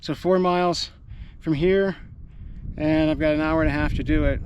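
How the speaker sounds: usual speaking voice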